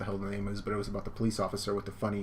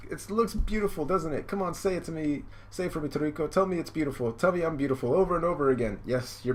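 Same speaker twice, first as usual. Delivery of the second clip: gushing bride, pitched up; ecstatic; 6 semitones higher than usual. Acted. Bride begs groom for praise, ecstatic gushing. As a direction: gush in a higher acted voice. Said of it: "she's just ecstatic".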